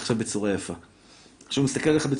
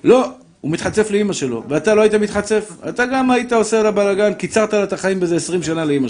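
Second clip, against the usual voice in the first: higher and louder, hammering the fathers; fiery rebuke; 7 semitones higher, 4 dB louder than usual. Acted. Preacher scolding fathers who yell at kids, loud.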